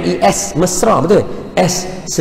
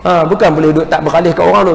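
ordinary delivery

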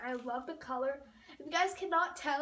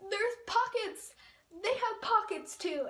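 higher, louder excited exclaim; 6 semitones higher, 4 dB louder than usual. Real, not acted. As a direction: burst out happily. Excited about pockets.

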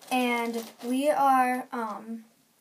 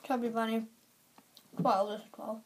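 cheeks stuffed full; 4 dB quieter than usual. Real, not acted. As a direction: mouth crammed with marshmallows. Many marshmallows in mouth.

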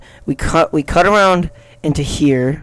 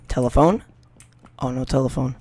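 normal talking voice

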